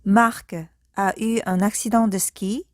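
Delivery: usual speaking voice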